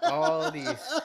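breaking up laughing, almost crying. Real, not acted. Venting to his friend about clingy girls, laughing hard. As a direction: squeeze the words out through laughter.

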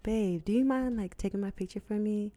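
high, soft, coaxing request; sweet voice. Shown as a demonstration. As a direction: sweet-talk a boyfriend into a favor. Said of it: "in a sweet voice".

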